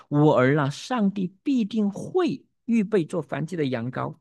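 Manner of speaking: slow, rising, with “会” lifted and held; prophetic stress on 会. Acted. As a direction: pronounce it like a prophecy, leaning on “会”. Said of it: "他发出预言". (缓慢地强调“会”字，像在宣告预言)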